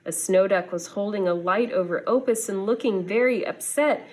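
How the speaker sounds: usual speaking voice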